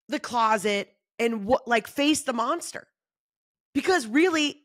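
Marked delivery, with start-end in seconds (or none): none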